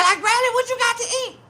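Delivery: put-on very high child's voice; kid voice. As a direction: ask like an eager little kid. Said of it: "He said".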